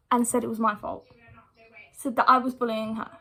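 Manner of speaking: ordinary delivery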